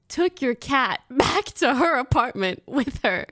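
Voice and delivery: laughing through the words. Real, not acted. Manager reporting who took the cat, laughing. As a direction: giggle while telling it.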